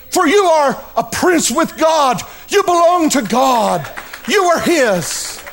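rising, ringing proclamation, slowing to a close; proclaiming shout. Acted. Angel answering Jacob, ringing proclamation. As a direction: proclaim it like a heavenly herald.

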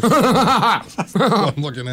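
slow words through laughter; laughing. Real, not acted. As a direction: laugh while you say it. Laughing at the feeding scene.

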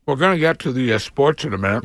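talking while chewing; mouth full. Real, not acted. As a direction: say it through a mouthful of sandwich. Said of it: "you can't talk with your mouth full".

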